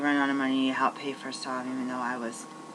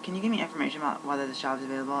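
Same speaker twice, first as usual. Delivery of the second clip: rushed polite request; much faster than usual. Retold or imitated. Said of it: "just like this job".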